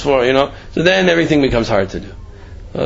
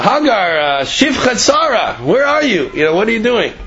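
calling out; 8 semitones higher than usual. Retold or imitated. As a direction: call to her from afar. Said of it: "called out to her".

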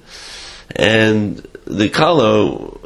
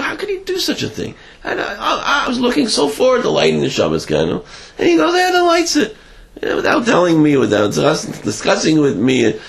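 lightly voiced distraught wife, higher; a hint of a hurt, indignant bride; mild mock wail of a let-down bride; upset young wife; 10 semitones higher than usual.

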